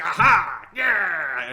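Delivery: put-on loud whoop of glee, like a kid at an arcade; mock gleeful whoop. Imitating the pilot having fun in the cockpit, loud whoop. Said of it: "grimacing and laughing and cackling".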